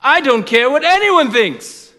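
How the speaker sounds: loud, high outburst; exasperated judge. Acted. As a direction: lose patience and raise the voice as the judge. Judge rejects the widow's appeal.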